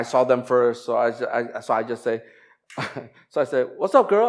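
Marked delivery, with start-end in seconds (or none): 3.8-4.3 put-on bright, casual "What's up, girl?" pitched high; casual greeting. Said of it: "not, like, the typical, "Hello".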